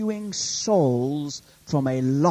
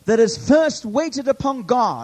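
high, loud proclamation; fervent preacher at full voice; full-force preaching; 9 semitones higher, 8 dB louder than usual. Real, not acted. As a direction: drive it out to the whole congregation.

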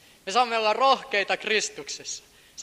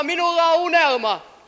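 louder, higher proclamation; shouted quote; 9 semitones higher, 6 dB louder than usual. Retold or imitated. Preacher shouting King's words to the congregation. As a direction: cry the dream out. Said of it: "Hän huutaa".

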